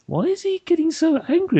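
high-pitched, bewildered mock question; baffled British bystanders. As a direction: ask it in high, incredulous puzzlement. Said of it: "We, as British people, are like".

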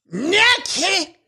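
high whiny cartoon kid; half-remembered Cartman voice; Cartman impression. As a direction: try a bratty cartoon boy, high and pinched. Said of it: "I'll make it awful sound like".

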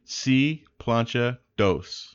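slow Spanish phrasing; bilingual host. Pretend. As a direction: say it slowly in Spanish. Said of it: "for our Spanish-speaking friends".

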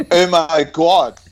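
ordinary talk

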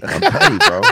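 high, loud, gleeful boast; crowing about being petty. Real, not acted. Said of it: "No, I'm petty".

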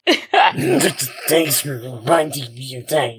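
slow, laughing through the words. Real, not acted. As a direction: laugh it out sarcastically.

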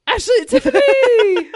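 high, loud squeal of names; shrieked through laughter; teasing outcry. Real, not acted. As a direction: call them out in delighted mock scandal. Teasing outburst over friends' romance reveal.

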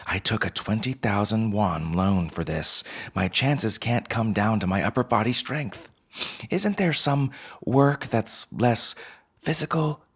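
worried student, rising to a high-pitched plea; pleading student. Acted. Student objecting to the advisor about a physical job, pleading.

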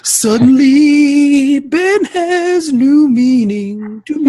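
drawn-out dramatic phrasing; slow dramatic. Acted. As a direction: stretch every word dramatically. Dramatic mock announcement.

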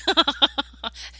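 loud high laugh. Real, not acted. Joking friend is super old.

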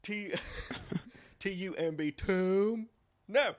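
high, slow dopey spelling voice. Retold or imitated. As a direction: spell it out like a clueless dope. Acting out Gallagher's spelling bit.

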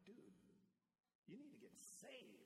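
high whiny complaint; hammed-up high whiny grandpa; mock-exasperated grandpa. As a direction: plead with the toddler in mock outrage. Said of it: "I'm like".

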